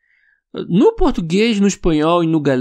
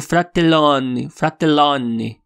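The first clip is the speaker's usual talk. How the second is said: fratellone dito devagar à italiana, com o L duplo; Italian pronunciation; 3 semitones lower, much slower than usual. Shown as a demonstration. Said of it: "A pronúncia deles". (fratellone em italiano, devagar)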